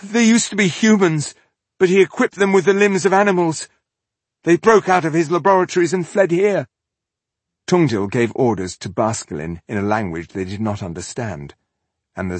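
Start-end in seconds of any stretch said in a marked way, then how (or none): 0.0-6.7 high, loud, fearful rush; terrified captive describing monsters; breathless with fear. A narrator's reading. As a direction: gasp it out scared. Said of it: "breathless with fear".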